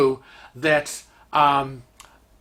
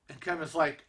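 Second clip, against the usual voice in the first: ordinary delivery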